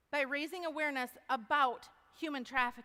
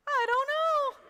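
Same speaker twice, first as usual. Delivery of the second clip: high, loud cry of not knowing; mock helpless wail; 13 semitones higher, 10 dB louder than usual. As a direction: throw up your hands and wail it. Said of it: "I go".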